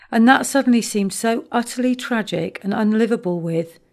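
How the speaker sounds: plain reading voice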